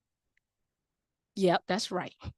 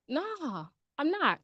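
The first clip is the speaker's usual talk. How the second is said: soft dismissive nah; 4 semitones higher, 7 dB quieter, much slower than usual. Acted. Person brushing Sam off.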